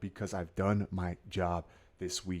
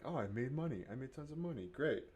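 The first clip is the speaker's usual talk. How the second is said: relaxed winner voice; 6 semitones higher, 6 dB quieter than usual. Retold or imitated. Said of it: "where you're just like".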